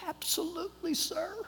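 high, soft woman's voice; obliging stewardess. Retold or imitated. Stewardess granting a passenger's seat request, high and soft.

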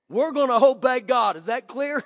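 forceful retold vow; emphatic resolve. Retold firm declaration to wife.

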